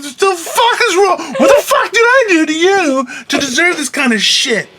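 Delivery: very high mock-crying protest; hammed-up wail of a wronged movie watcher; imitated tearful outburst. Host retelling crying at the wife over a movie, mock wailing. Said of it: "I'm like crying".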